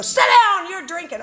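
high, loud snap of a command; imitating a room ordering a drunk to sit; mock shout. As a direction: bark her back to her seat. Members shouting her back down.

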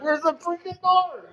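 very high, drawn-out frantic cry. Retold or imitated. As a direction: act out desperately hunting for a way in. Frantic search for hospital door.

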